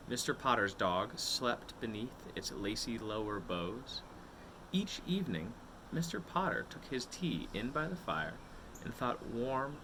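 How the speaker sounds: usual speaking voice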